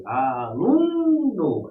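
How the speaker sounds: "aluno" with LU stretched out long; slow "a-LUUU-no", teacher's demo; stress on penultimate syllable. Shown as a demonstration. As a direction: hold the middle syllable of "aluno". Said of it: "é a penúltima".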